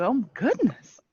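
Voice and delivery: a Southern Belle clutching her pearls; mock Southern Belle gasp. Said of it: "in the most Southern Belle".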